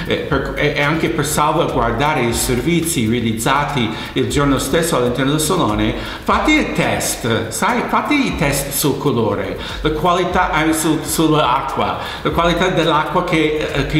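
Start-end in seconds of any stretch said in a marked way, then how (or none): none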